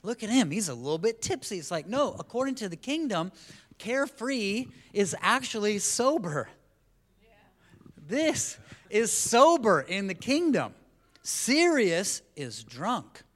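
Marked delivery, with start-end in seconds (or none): 0.0-1.6 high, loud jeering voice; playful mockery; mock teasing onlooker. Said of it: "we're like". 3.8-13.2 high, loud, slow proclamation; emphatic sermon punchline; preaching at full force. Acted.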